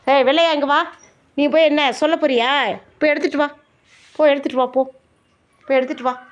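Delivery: loud, high-pitched command to a pet; real stern scolding. Real, not acted. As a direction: call it over sharply and order it to fetch. Scolding the pet, ordering it to fetch. (சத்தமா அதட்டி ஏவும் குரல்)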